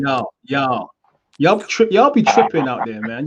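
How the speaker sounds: put-on goof around in a Southern American accent; hammed-up American accent. British host teasing the boxer in mock American accent. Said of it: "you got it".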